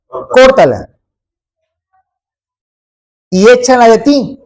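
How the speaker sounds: loud, high preaching of the verse; forceful scripture reading. Acted. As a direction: proclaim the Bible passage with force. (lectura bíblica proclamada con fuerza)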